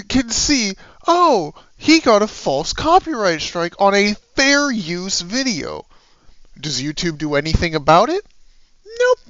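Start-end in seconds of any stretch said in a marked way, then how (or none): none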